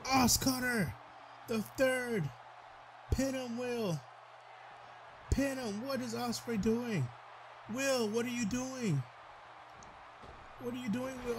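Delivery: high, frantic pleading with the wrestler; frantic urging. Real, not acted. Commentator begging Will to pin, high and frantic. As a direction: yell at him to make the pin.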